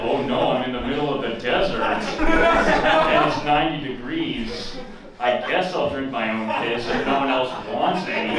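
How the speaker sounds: mock Bear Grylls. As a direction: ham up the desert peril. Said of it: "It's like".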